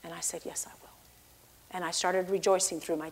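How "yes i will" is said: barely audible whispered assent; tearful surrender. Retold or imitated. Believer crying and agreeing with God, whispered surrender. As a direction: give in with a tiny whisper. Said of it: "I cried".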